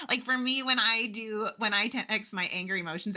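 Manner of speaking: ordinary talk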